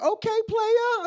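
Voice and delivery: very high, drawn-out mock reply; loud, slow high-pitched imitation; acted reply. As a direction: stretch it out slowly, way up high.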